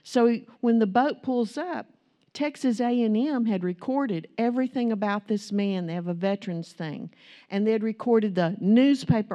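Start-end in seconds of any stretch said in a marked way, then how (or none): none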